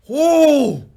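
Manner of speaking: loud, high exasperated "ooh"; hammed-up howl of mounting anger; angry "ooh". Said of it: "Now I'm getting mad".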